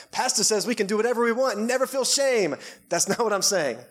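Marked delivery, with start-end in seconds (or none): 0.1-2.5 high, singsong mock quote; mock congregant. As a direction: mimic someone gleefully misreading the pastor. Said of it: "I'm not saying, like".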